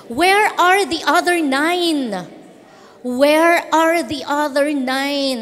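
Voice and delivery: slow, searching question to the crowd; drawn-out, emphatic scripture reading; preacher quoting Jesus. Acted. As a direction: stretch each word of the question. Reads Jesus' question aloud.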